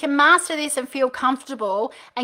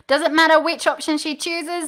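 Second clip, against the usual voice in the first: usual speaking voice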